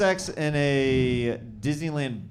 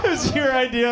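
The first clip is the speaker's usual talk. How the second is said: ordinary delivery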